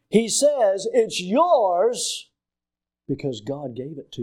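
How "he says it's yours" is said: slow build to a long, stretched "yours"; stress on YOURS. Real, not acted. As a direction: drive home the word "yours". Preacher drives home whose it is.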